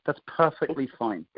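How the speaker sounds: slow, "perfectly" stressed in "That's perfectly fine"; relaxed takeaway with weight on "perfectly"; emphasis on perfectly. Shown as a demonstration. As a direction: lean into "perfectly", unhurried. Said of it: "a little bit of emphasis".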